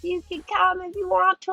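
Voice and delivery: friendly campers. Retold or imitated. Campers offer her a place.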